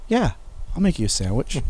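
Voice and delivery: understated, drawn-back yes with mischief behind it; sly agreement. Retold or imitated. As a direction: imitate a brother agreeing with a secret plan. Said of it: "he'd get this look like".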